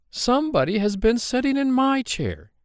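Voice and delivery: high-pitched mother bear at moderate volume; middle-sized Mama Bear. A narrator's reading. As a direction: lift it high, without shouting, as the mother bear. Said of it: "said the Mama Bear in her middle-sized voice".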